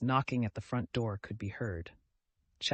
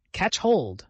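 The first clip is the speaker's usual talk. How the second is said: ordinary delivery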